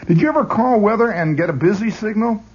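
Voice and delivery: usual speaking voice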